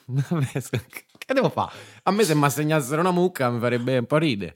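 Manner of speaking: laughing it off; breaking character giggling. Real, not acted. Still laughing after the fit.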